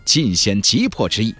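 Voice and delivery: usual speaking voice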